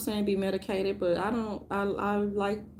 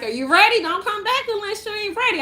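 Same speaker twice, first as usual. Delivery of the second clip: mock drill sergeant; 12 semitones higher, 5 dB louder than usual. Rehab specialist yelling at him.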